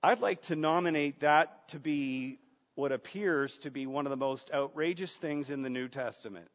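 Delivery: ordinary delivery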